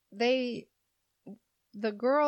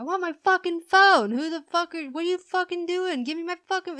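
put-on higher, louder ranting boyfriend; mock angry boyfriend; 8 semitones higher, 4 dB louder, faster than usual. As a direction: rant like a man demanding his phone. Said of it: "he was like".

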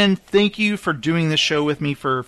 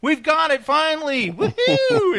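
high, whooping mock celebration; hammed-up high-pitched elation; retold triumph; 12 semitones higher than usual. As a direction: cheer as if the long hunt is over. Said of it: "And I was like".

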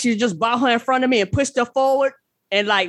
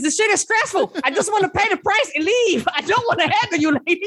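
mock panicked plea; 7 semitones higher than usual. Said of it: "'cause I was like".

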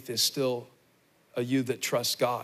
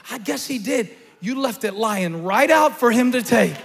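full-force preaching, higher and louder; 11 semitones higher, 9 dB louder than usual. Real, not acted. Preacher scolds the congregation for leaving the door open, full-force preaching. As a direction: bring the rebuke home with force. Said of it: "Now I'm preaching to me".

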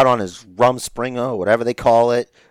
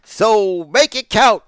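announcer voice; 4 semitones higher, 4 dB louder, much slower than usual. Retold or imitated. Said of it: "Snider's got a party message".

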